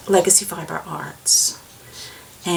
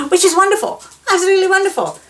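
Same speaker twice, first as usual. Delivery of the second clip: high, louder gushing delight; mock delight; 12 semitones higher, 5 dB louder, much faster than usual. Real, not acted. Knitter relieved the squeaky ball is silent, high gushing delight. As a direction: gush over it, tongue in cheek.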